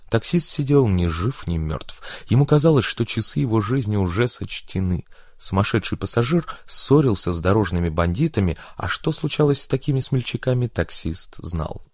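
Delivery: usual speaking voice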